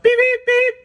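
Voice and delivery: high, loud mock horn beeps; playful high-pitched toot-toot; imitated horn honk.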